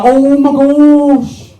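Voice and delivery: high, mock-panicked "oh my gosh"; a hammed-up hysterical neighbour; mock hysterical woman. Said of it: "she's hysterical".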